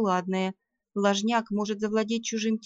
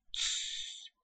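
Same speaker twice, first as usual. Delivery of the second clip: breathy urgent hush, no voice; whispered shush; 12 dB quieter than usual. Acted. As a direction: shush them in a sharp whisper. Urgent hush, danger outside.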